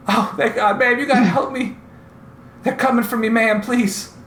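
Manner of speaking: high, urgent desperate plea; pleading. Acted. Bleeding old man begging the party to help him. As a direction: beg for help, breathless and scared. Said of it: "And he goes".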